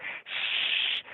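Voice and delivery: whispered shush; hushing shh. Retold or imitated. Toddler hushing the whole visiting room, whispered shush. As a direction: hush the room with a finger up. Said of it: "He's like".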